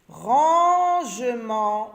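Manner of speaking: Rangement drawn out very slowly, with the rang held long; stretched word. Shown as a demonstration. Teacher stretches Rangement for the children. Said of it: "syllabe rang".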